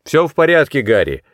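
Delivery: calling out. A narrator's reading. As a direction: call it across to a partner. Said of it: "крикнул Венески". (громкий оклик напарнику)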